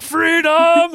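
high, loud hammed-up cry; mock battle cry. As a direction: holler it like a comic escape. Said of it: "she's just like".

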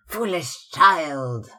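a mother's scolding parroted back at her daughter; parrot phrase flung. Acted. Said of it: "the parrot phrase flung in answer".

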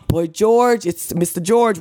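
high-pitched, playful awe; starstruck fan greeting. Retold or imitated. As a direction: greet your idol, voice lifted. Starstruck greeting of idol retold.

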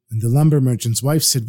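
ordinary delivery

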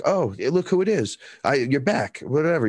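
high-pitched surprised greeting, lightly acted; mock startled recognition; acted surprise. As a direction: react as if caught off guard. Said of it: "I was shocked".

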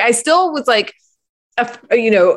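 ordinary delivery

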